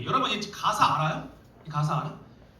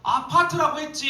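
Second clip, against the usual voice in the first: angry snap; 9 semitones higher, 6 dB louder than usual. Retold or imitated. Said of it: "이랬더니 또 부릅니다".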